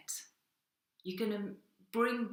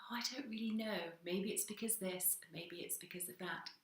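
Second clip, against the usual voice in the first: softer and softer, trailing off into a mumble; mock mopey mumble; 9 dB quieter than usual. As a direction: shrug it off, fading to nothing. You mumble vague reasons, fading.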